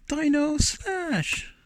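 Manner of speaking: high, slow drawn-out arcade game shout; terrible impersonation. Retold or imitated. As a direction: belt it like a hammy game announcer. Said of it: "my terrible impersonation of saying".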